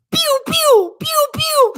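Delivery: very high-pitched pew sounds; high playful zapping; imitated laser noises. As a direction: squeak out toy laser shots. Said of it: "why do you always make noises?".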